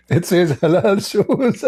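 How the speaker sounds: high, slow mocking laugh. Real, not acted. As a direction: draw it out and laugh.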